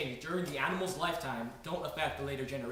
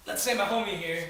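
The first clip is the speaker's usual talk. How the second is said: higher and louder, calling attention; louder repeat; 4 semitones higher, 5 dB louder than usual. Shown as a demonstration. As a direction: say it again louder. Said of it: "repeated for attention".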